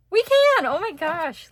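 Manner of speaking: excited high burst; thrilled squeal. Real, not acted. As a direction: squeal with joy it fits. Delighted that pieces fit.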